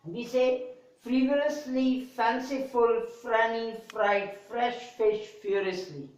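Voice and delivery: every F sound crisp, lips closing on it; clear F sound. Shown as a demonstration. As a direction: press the lips and push out each F. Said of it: "we close our lips and then we say".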